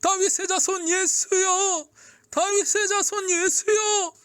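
very high, drawn-out desperate shout; crying out even harder. A narrator's reading. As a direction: call with every last bit of strength. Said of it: "더 크게 외쳤어요". (젖먹던 힘까지 다한 외침)

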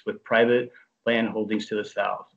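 ordinary talk

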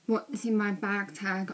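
normal talking voice

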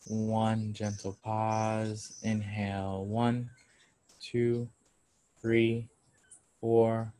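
measured, evenly spaced counting with drawn-out cues; guided breathing count. Acted. Yoga teacher leads class through counted breaths, slow meditative guidance. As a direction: pace the breath calmly, leaving space after each count.

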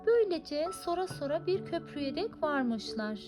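ordinary delivery, nothing marked

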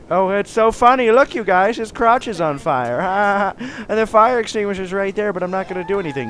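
high, loud mocking glee; mock amusement. Player pretending to enjoy burning man's trouble, mock gleeful. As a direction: jeer with a put-on laugh. Mocking onlooker, then just kidding.

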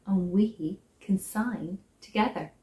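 ordinary delivery

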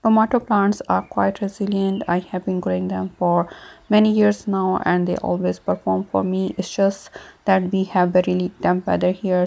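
normal talking voice

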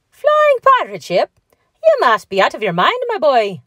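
high, loud, swooping disbelief; incredulous scoffing. A narrator's reading. Patrick tells Mickey he must be mad, incredulous scoffing.

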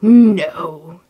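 loud, forceful no; emphatic refusal. A narrator's reading. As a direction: cut off the plea as a merciless genie. Genius refuses the merchant's plea.

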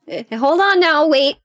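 alarmed interjection. Acted. Bystander halting a fight over the insult, alarmed. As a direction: cut in urgently, pitch jumping up.

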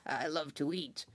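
lightly voiced hungry townsman, soft; round man. Round man with the pot telling the cooks, lightly voiced and soft. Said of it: "he said".

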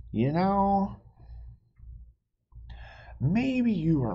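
high, animated storybook voices; high read-aloud with a little hushed squabble; reading to children. Acted. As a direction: play both bickering friends for kids. Reading picture book, two voices.

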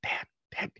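hushed, breathy call to Dad; whispered call. Retold or imitated. Daughter coming down, calling for her dad in a whisper. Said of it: "she's like".

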